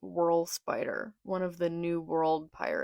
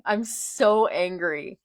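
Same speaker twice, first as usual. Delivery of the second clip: "so" stretched and falling, slower and louder; exasperated slow whine; 7 dB louder, slower than usual. Real, not acted. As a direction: drag "I'm so angry" out in exasperation.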